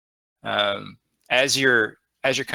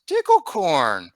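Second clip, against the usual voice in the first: slow, higher kobold voice, falling at the end; Meepo's answering call; 5 semitones higher, 3 dB louder, slower than usual. Acted. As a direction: answer back, stretched out and falling. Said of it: "And then Meepo goes".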